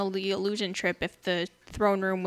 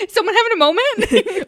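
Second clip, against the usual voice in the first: giggling, high and loud; laughing mock question; 11 semitones higher, 9 dB louder than usual. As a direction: laugh through the words. Mocking Jon interrupting.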